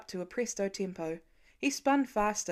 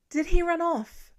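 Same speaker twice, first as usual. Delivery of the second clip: high, louder frightened question; alarmed; 8 semitones higher, 7 dB louder than usual. Acted. Mother asking if her son ran off, high and scared. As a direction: gasp it out in sudden fear.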